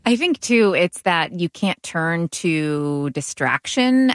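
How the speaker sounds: ordinary talk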